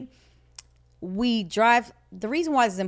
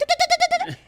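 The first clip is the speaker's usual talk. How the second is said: rapid-fire stuttered tuh-tuh noise; mimicked whirring-brain noise; imitated racing mind; 4 dB louder than usual. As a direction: rattle off quick ticking sounds. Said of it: "My mind is like".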